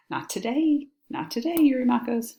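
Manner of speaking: gloating "no chance" tease; mock taunt. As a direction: taunt him playfully, pitched high. Mocks the schemer's plea.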